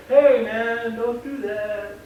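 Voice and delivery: a lightly voiced slow, casual "hey man"; too easygoing to matter; laid-back mild correction. Shown as a demonstration. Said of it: "you're not gonna go".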